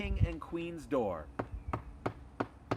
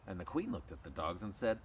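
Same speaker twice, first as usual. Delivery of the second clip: ordinary delivery, nothing marked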